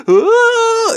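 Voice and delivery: extremely high shriek; retold scream. Speaker at the peak of the retold row, shrieking. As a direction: end the tirade in a piercing shriek. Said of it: "they were screaming".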